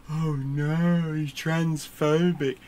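mock-horrified gasp at a slow pace. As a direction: gasp and clutch your pearls. Mock outraged critic.

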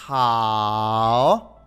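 "hao" drawn out low, then curling up; third-tone model. Shown as a demonstration. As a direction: slowly demonstrate "hao" with a full dip and rise. Teacher models formal greeting slowly.

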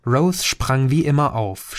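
usual speaking voice

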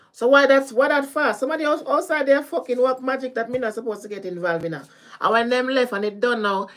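high, loud indignant venting; agitated protest. Real, not acted. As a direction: protest loudly at being dragged into it. Protesting being dragged into others' magic.

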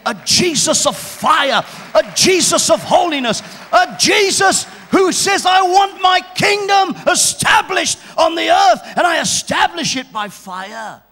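full-force revival shout; fiery preaching. Real, not acted. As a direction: thunder it from the pulpit.

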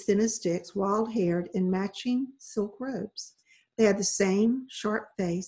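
ordinary delivery, nothing marked

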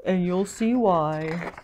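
slow, low resigned fish; mock glum. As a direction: drag it down into a sulk. Glum fish makes his excuse.

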